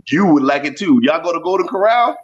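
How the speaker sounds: normal talking voice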